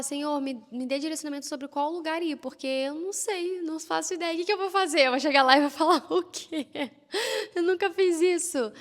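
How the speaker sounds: begging God for direction, overwhelmed and half laughing; anxious prayer. Retold or imitated.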